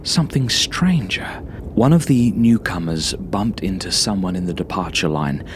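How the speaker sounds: ordinary delivery, nothing marked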